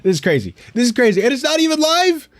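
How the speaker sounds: building to a high-pitched protest; exasperated amazement, pitch shooting up; incredulous. Real, not acted. Overwhelmed host marvelling at workload.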